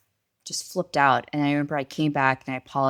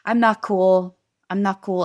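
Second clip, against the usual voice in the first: louder, higher admission of cracking; retold snap, raised voice; losing cool; 5 semitones higher, 8 dB louder than usual. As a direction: burst out that you've hit the limit. Retold breaking point.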